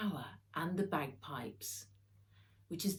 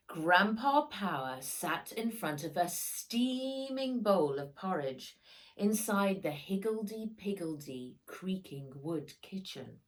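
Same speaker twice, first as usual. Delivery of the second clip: ordinary delivery, nothing marked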